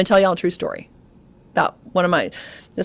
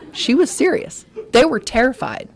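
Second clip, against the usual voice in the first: normal talking voice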